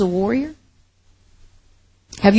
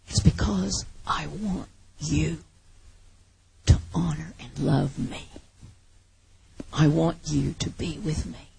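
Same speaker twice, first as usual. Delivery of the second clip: God speaking softly, much quieter and half-whispered; tender whisper; 4 semitones lower, 3 dB quieter than usual. Retold or imitated. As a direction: breathe it gently, like a loving confession. God's voice, tender appeal.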